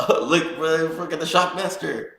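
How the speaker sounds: high, loud, starstruck fan voice; excited fan. Retold or imitated. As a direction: blurt it out like a giddy fan. Excited fan spotting a wrestler.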